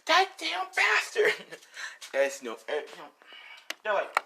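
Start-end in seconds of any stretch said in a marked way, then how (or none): 0.1-1.3 slow, very high mock fury; acted seething exclamation; angry outburst. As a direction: stretch out the curse, pitched way up. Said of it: "like, outright says".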